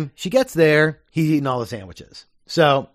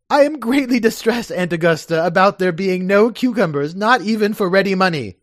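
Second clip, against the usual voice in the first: much higher, mock distress; hammed-up fretting nephew; Algernon; 5 semitones higher than usual. Algernon apologizes to his aunt, high and fussy.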